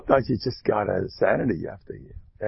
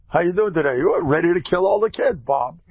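higher and louder, hammed-up cheerful greeting; mock Bob greeting; 7 semitones higher, 6 dB louder than usual. Rabbi joking with Bob before class, hammed-up greeting. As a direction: play a jokey pal egging Bob on.

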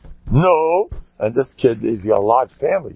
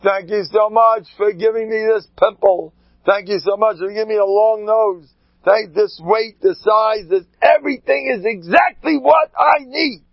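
high excited thanking, building to a shout; gushing thanks; 8 semitones higher than usual. Pretend. Thanking God for a pimple and long nose, gushing high. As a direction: gush thanks to heaven. Mock grateful prayer.

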